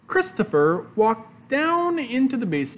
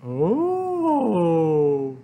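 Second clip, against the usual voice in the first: touched mother's "oh". A narrator's reading. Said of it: "said his mother".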